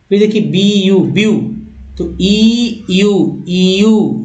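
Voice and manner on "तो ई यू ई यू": ई यू said slowly, two separate vowels; slow vowel split. Shown as a demonstration. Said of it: "इसका भी दो उच्चारण है". (ई यू धीरे अलग-अलग)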